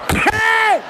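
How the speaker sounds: high startled yelp; startled high-pitched hey; alarmed cry. Retold or imitated. Alarmed outburst.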